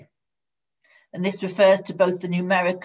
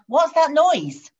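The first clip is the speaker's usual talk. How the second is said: high, slow, louder worried question; concerned and worried; 10 semitones higher, 5 dB louder, slower than usual. A narrator's reading. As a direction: ask as an increasingly worried boss. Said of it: "growing a bit concerned now and worried".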